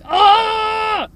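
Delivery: high, loud drawn-out "oh"; venting scream. Acted. Trapped parent venting to listeners, loud cry. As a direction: let out a pent-up holler. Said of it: "I feel like I'm just screaming".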